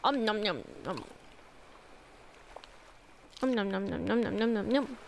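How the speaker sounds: soft nom nom eating noises; munch greedily, lips smacking; greedy smacking while eating. Pretend. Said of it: "I love the face I make when I eat".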